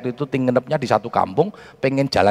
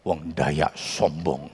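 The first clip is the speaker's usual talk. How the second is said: muttered complaint; 8 semitones lower, 7 dB quieter, much slower than usual. Retold or imitated. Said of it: "saya ngomong gini sama teman saya".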